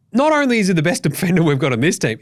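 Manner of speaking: usual speaking voice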